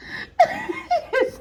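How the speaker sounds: shrieking burst of laughter; high squealing laugh. Real, not acted. Laughing at the story.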